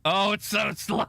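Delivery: laughing through a high taunt. Real, not acted. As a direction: giggle mid-sentence while teasing. Taunting, laughing at own joke.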